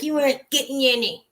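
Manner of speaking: a hint of a nasty old Italian lady; mean old lady. Seller imitates the old lady on the cat video, lightly mean voice. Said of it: "she's so mean".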